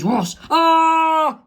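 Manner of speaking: whoosh then high loud scream; cops scream. Acted. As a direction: shriek as they vanish. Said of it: "Both of the cops say".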